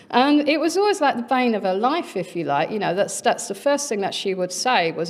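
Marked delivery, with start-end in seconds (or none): none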